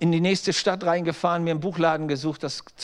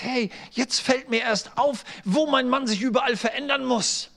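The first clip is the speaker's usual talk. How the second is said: higher-pitched mock indignation of a wife; imitated indignant wife; 6 semitones higher than usual. Wife tells the pastor her husband must change, mock indignant. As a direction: play the wife's sudden realization, pitched up.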